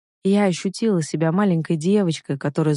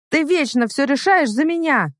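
high-pitched, protesting exclamation; indignant outcry; 9 semitones higher than usual. A narrator's reading. Heroine protesting to the man who decides for her, indignant outcry. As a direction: cry out in stubborn protest. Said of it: "выкрикнула я".

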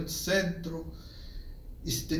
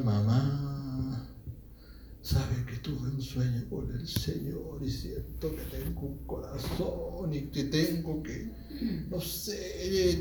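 retold son's quiet apology; soft plea, retold; 7 dB quieter than usual. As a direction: plead gently with mother, much softer. Retelling his plea to his mother.

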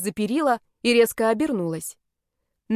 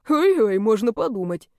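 scoffing snort; 3 dB louder than usual. A narrator's reading. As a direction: snort at her boast. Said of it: "фыркнул он в ответ". (насмешливо фыркает)

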